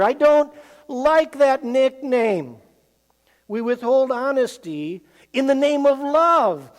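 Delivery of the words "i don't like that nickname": best friend voice. Retold or imitated.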